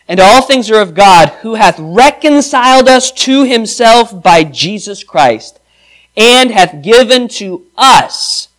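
loud, slow, ringing reading of scripture. Acted. Preacher reads the passage to the church, loud and slow. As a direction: proclaim the verses to the whole room.

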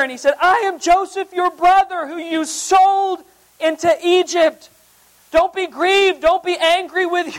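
high, loud, emotional scripture proclamation; impassioned reading. Acted. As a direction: proclaim Joseph's forgiveness with full fervor. Joseph's words read with passion.

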